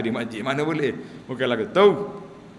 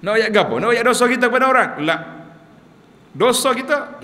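rising rebuke; 8 semitones higher, 5 dB louder than usual. Acted. Preacher rebukes confessing sins.

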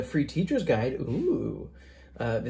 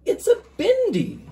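much higher and brighter, a little louder; proud reveal; 4 dB louder than usual. Acted. Ami telling her friend what the dot is, proud and excited. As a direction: announce it like a delighted child.

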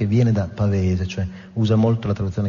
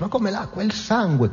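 much higher, incredulous exclamation; acted indignant correction; 11 semitones higher than usual. Ahab rejects water for tempering the harpoon, high-pitched indignation. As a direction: pitch it way up, mock-scandalised.

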